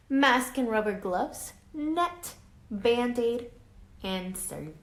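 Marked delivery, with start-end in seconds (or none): none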